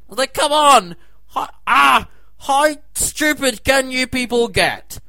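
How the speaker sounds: high, loud furious rant, slowing at the end; exasperated at the critics, high and loud; exasperated shout. Real, not acted. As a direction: yell at the complainers.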